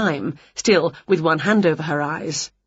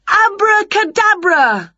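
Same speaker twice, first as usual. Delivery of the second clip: high, loud, slow incantation; drawn-out high-pitched spell cry; casting a spell; 13 semitones higher, 5 dB louder, slower than usual. A narrator's reading. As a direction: proclaim the magic word grandly. Witch casts her magic spell.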